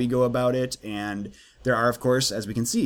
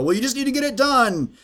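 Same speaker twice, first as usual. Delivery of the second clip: higher, louder mock brush-off; dismissive coworker; 13 semitones higher, 5 dB louder than usual. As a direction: imitate a dismissive colleague shrugging off the question.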